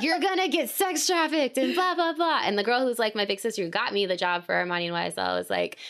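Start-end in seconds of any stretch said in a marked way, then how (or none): 0.0-2.4 high-pitched mimicked warning; exaggerated, high nagging alarm; mock alarmed warning. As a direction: mimic friends panicking on your behalf.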